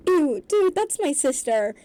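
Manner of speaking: loud, high, disgusted; appalled brother's protest; grossed-out. A narrator's reading.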